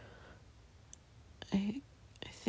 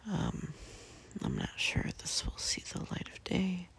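whispering. Real, not acted. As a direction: whisper it all, barely voiced. Whispers from bed throughout.

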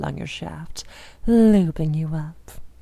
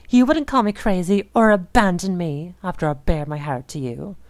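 usual speaking voice

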